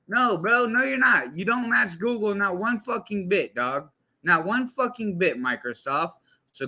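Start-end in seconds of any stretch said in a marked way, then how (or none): none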